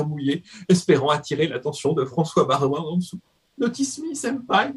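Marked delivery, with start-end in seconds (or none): 3.6-4.7 put-on high, pleading anime girl voice; overacted anime dub. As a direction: beg sweetly like an anime fan. Mock anime plea to Baroin.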